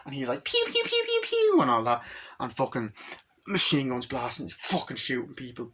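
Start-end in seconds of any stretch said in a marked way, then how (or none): 0.5-1.6 very high-pitched pew noises; imitated gunfire. Retelling the snowmobile shootout, imitated gunfire. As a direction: squeak out toy laser shots.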